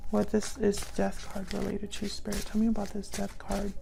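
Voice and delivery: quiet, reverent ask; hushed request to spirit. Real, not acted. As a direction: ask the spirit softly, almost under the breath. Asking spirit about death card.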